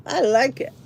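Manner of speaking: ordinary talk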